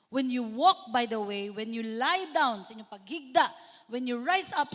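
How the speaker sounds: loud, emphatic reading of the verse; scripture reading. Acted. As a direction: proclaim the verse to the congregation. (kusog nga pagbasa sa Pulong)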